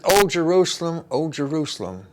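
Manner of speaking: high, loud first cry, then easing down; calling out, lamenting. Retold or imitated. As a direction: appeal to a whole city, falling away. Jesus calls out to the city.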